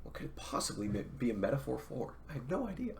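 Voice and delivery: ordinary delivery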